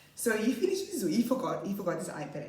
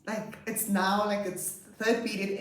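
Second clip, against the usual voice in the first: ordinary talk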